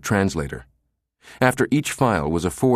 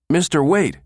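high-pitched call after someone; 10 semitones higher, 4 dB louder than usual. A narrator's reading. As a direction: call to stop him leaving. Girl calls after man walking away.

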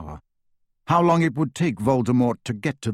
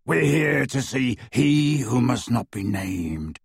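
slow rasping werewolf; slower than usual. A narrator's reading. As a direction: rasp it out like a beast. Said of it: "rasped Greyback".

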